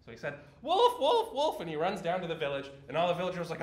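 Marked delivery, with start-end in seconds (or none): 0.6-1.6 put-on high, loud alarm call of a boy; pitched-up shout of wolf, wolf; crying wolf. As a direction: yell it out to the village like a lying shepherd boy. Boy in story cries wolf.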